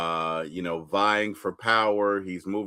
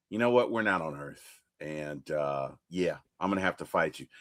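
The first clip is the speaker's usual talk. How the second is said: lower, softer Hulk decision; 8 semitones lower, 6 dB quieter than usual. Retold or imitated. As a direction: say it low and settled.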